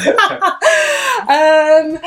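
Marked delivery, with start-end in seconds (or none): 0.5-1.2 high laugh. Real, not acted. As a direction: burst of high-pitched giggling. Laughing before drunk example.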